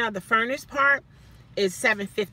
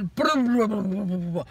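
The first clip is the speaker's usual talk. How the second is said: imitated stomping noise; 4 semitones lower than usual. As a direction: make the stomping sound.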